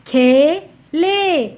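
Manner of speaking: खे and ले slow and separate; sounding out. Shown as a demonstration. Teacher reading to children, syllable by syllable. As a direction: stretch both halves: खे... ले. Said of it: "उच्चारण करके पढ़ लिया".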